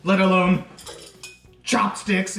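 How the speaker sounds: hammed-up horror, broken by pauses; mock outrage. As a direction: play up the scandalized disbelief. Acted horrified guest's realization.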